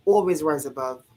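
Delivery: usual speaking voice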